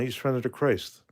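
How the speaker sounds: normal talking voice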